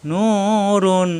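drawn-out Quranic nurun, slow; slow drawn-out recitation. Shown as a demonstration. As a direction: stretch Nurun with a long vowel. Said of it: "একালিপ টান".

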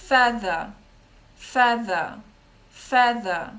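"Feather" repeated loudly with a voiced th; loud feather drill. Shown as a demonstration. Teacher drills feather for the class, loud. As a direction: model feather loudly three times. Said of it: "повторіть".

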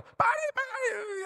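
very high-pitched comic panic cry; mock screaming. Disciple in the storm begging it to stop, mock screaming. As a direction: squeal for it to stop. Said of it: "a ratos estaría gritando".

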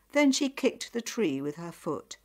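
plain reading voice

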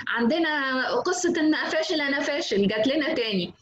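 high, festive ululation sliding into gleeful news; ululating announcer. Shown as a demonstration. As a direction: cheer the bad news like a wedding. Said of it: "المذيع التاني كده يقعد يزغرط". (مذيع بيزغرط بفرح وصوت عالي)

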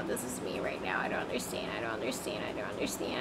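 breathless defeated whisper. Shown as a demonstration. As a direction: whisper it out, fading away.

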